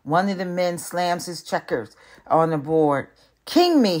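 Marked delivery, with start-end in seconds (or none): 3.4-4.0 high, loud winning call; triumphant shout. Acted. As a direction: crow it over the board. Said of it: "he shouts with his arms raised high".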